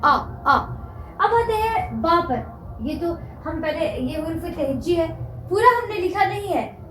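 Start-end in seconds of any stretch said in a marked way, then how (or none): 0.0-0.6 आ said as a long open aa; drawn-out aa sound. Shown as a demonstration. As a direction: stretch out आ clearly. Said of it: "वो आ होता है". (लंबा खुला आ स्वर)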